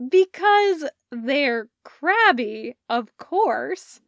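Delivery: beaming tease. Acted. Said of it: "Parker beamed".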